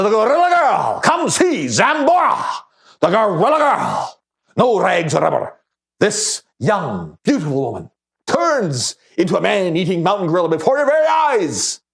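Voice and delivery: loud, high showman's shout with stretched names; carnival barker calling out. Acted. Barker pitches the Gorilla Girl to the crowd, calling out. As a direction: holler it like a sideshow barker. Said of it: "the barker calling out".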